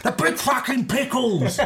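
hammed-up fed-up chef spelling it out; mock exasperation.

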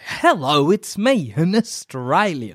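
slow, hammed-up fake Aussie, off like Cockney; mock an American botching Australian; bad Australian accent. Mocking American actors playing Aussies, bad Australian accent. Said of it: "Aussie character that's played by an American".

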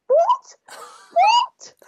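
imitated incredulous squeak. Partner asked to walk the dog, incredulous squeak. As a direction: squeak it out in utter disbelief. Acting partner's disbelief at request.

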